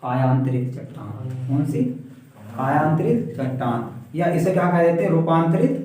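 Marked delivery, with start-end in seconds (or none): 0.0-1.5 drawn-out, syllable by syllable for the class; slow dictation. Shown as a demonstration. Teacher dictates term to class. 2.6-3.9 drawn-out term, repeated for pupils to copy; slow dictation. Shown as a demonstration. Teacher repeats term for class.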